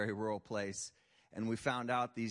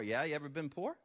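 ordinary delivery

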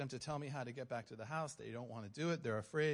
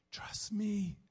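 high, intense whisper; voice of the Lord; 8 semitones higher, 4 dB louder than usual. Retold or imitated. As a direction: press it out as an urgent whisper. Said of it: "the Lord's just like".

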